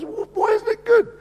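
high stammering squeak; alarmed. Retold or imitated. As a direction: stammer it in mock panic. Son alarmed, stammering question.

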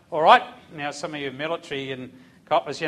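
sergeant's bark. Retold or imitated. Drill sergeant voiced in story.

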